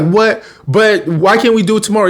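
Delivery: high-pitched mock dismay; imitated protest. Bree argues with Mister Davenport, imitated whiny protest. Girl protests being told no.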